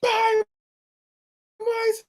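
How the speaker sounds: high, slow, teary whine; Montel impression. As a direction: drag the words out, half laughing. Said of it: "Like Montel".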